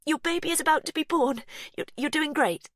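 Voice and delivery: high and loud; anxious encouragement. A narrator's reading. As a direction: cheer her on, shaken. Encouraging mother.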